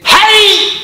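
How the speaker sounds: high, loud playful shout; loud call. Real, not acted. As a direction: yell to get his attention. Calls out to guest. (interpelle l'invité en criant fort)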